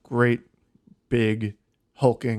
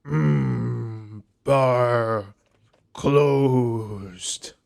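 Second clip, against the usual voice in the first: gruff orc; much slower than usual. Acted. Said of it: "the orc says".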